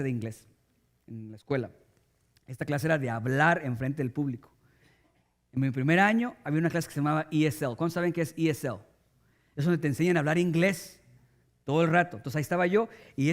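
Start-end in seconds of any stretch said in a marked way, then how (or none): none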